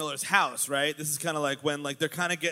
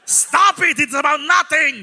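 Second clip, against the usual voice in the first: loud, much higher exasperated yell; shouting; 7 semitones higher, 10 dB louder, slower than usual. Acted. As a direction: yell it like a frantic sitcom neighbor. Mock Seinfeld quarrel, yelling.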